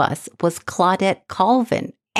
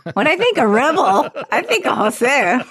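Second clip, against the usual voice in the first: laughing; 8 semitones higher than usual. Real, not acted. Teasing friend about being rebel.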